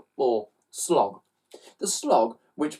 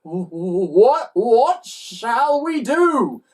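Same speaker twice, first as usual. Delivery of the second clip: stammering, then higher, louder, slow; scared stammer; 9 semitones higher, 6 dB louder, much slower than usual. Acted. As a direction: stutter with fright. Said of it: "the pair were scared".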